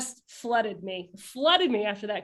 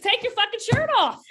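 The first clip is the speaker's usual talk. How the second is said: laughing mock order; 11 semitones higher, 4 dB louder than usual. Role-reversal joke, mock ordering Doug.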